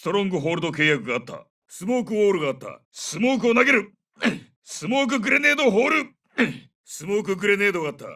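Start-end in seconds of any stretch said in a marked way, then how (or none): 2.9-6.1 high, projected combat call; grenade callout. Acted. Soldier tells squad smoke is thrown, urgent high callout.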